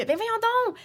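sudden high exasperated exclamation; indignant come on. Retold or imitated. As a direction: throw your hands up at the absurdity. Mock outrage at talk-show question.

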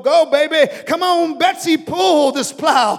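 father calling out to the donkey; urging the donkey. Acted. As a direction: drive Betsy on from behind the plow. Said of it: "I can be behind and go".

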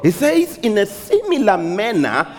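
high preacher voice with long-held words; impassioned preaching. Acted. Preacher voicing the shepherd's words to congregation, impassioned preaching. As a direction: proclaim it, stretching "know" and "mine".

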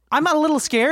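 high, loud scared patient. Acted. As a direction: play the frightened patient. Improv patient afraid.

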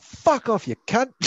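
high mock-Aussie yell; imitated Australian outburst. Paraglider kicking the kangaroo away, mock Australian shout. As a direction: send off an attacker in a hammed-up Australian voice. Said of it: "the most Australian paraglider ever".

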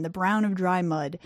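plain reading voice

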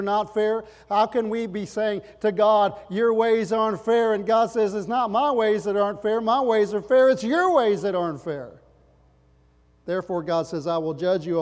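loud, driving, high-pitched preaching to the congregation; full-force preaching. Acted. Pastor exhorting the congregation to repent, loud fervent preaching. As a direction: preach it hard, rising with every line.

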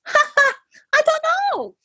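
high laughing shrug; laughing nurse. Retold or imitated. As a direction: laugh off the question.

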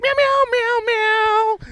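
very high squeaky meowing cat; nervous cat voice. Pretend. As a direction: meow like a tiny anxious cat. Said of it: "I'll be like".

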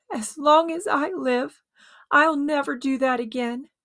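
a mild sobbing promise; between sobs. A narrator's reading. George alone in his room, lightly voiced sobbing vow. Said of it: "he promised himself between sobs".

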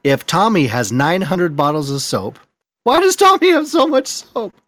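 building to a high squeaky question; mock the word problem, ending sky-high. Mocking math word problem.

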